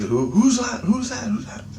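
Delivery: high-pitched mock excitement; excited questioning. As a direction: imitate an excited crowd asking in rapid repeats. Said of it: "everybody got excited".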